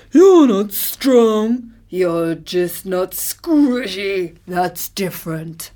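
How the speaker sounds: very slow, drawn-out elephant protest; sore-loser elephant. A narrator's reading. Elephant disputes the tortoise's win, grudging.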